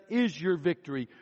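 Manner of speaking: lifted, rhythmic sermon cadence; preaching. Acted. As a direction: preach the promise with conviction. Preaching on resurrection and scripture.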